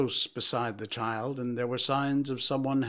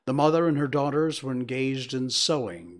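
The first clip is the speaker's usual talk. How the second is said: ordinary delivery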